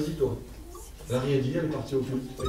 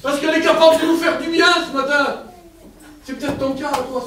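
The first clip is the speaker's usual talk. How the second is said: high and loud, driving each phrase home; preaching at full force; 10 semitones higher, 9 dB louder than usual. Real, not acted. Preacher exhorting the congregation, loud and fervent. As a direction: proclaim it to the whole church.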